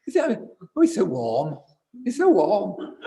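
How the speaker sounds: swooping high, badly faked camp Scottish; camp Scottish man. Mimicking the Scottish man on his favourite Buddha, gushing camp Scottish. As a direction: gush like a camp Scot, poorly imitated. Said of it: "this very camp, Scottish man".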